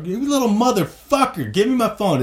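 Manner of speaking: put-on high, raging drunk yell through a door; mock drunken rage. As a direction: pound the door and scream for the phone. Drunk banging on bathroom door.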